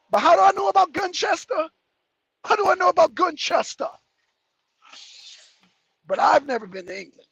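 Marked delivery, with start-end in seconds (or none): 0.1-3.9 mock bafflement. Mock-baffled rhetorical question.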